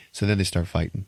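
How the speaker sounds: ordinary delivery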